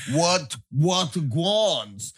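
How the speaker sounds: slow, hammed-up fake Jamaican "guans"; mock an American faking Jamaican patois; bad fake Jamaican. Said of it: "Americans that go and do Jamaican".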